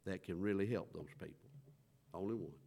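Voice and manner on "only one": Only one dropped soft and low; soft repeat of only one; quiet echo. Real, not acted. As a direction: let Only one fall to a hush.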